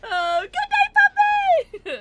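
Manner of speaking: high, loud farewell yell; excited shout. Real, not acted. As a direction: shout goodnight with gusto. Calling goodnight to departing friend.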